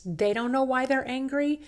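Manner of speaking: ordinary talk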